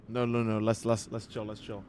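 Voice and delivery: soft, low self-soothing; calming himself down. Real, not acted. Streamer calming his own rage after the bait, soft and low. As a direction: talk yourself out of the rage quietly. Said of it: "let me slow down".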